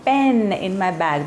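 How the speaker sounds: "pen" with a short e, said slowly; slow model of "pen in my bag"; correct short e in pen. Shown as a demonstration. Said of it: "मैं ये कहना चाह रही थी".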